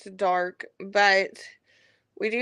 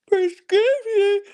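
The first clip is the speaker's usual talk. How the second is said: word stretched through a big yawn, high and louder; 11 semitones higher, 5 dB louder, slower than usual. Real, not acted. To viewers mid-yawn, word swallowed by a yawn. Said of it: "sorry, couldn't mute that one".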